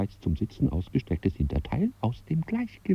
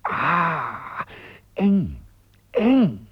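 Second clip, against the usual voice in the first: straining in pain; 8 semitones higher, much slower than usual. Acted. Customer forcing his foot into a tight shoe, straining. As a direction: squeeze the words out with effort.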